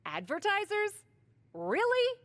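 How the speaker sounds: high, disbelieving "Advertisers? Really?"; incredulous. Acted. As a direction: pitch shooting up in disbelief.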